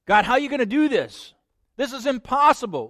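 high, loud exasperated plea; exasperated doubt. Acted. Doubter complaining to God, high and exasperated. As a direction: throw up your hands at God.

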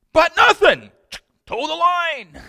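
high, loud mock mom snapping back; shrill mom. Preacher imitating a mom who won't take excuses, high and loud. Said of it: "Moms are like".

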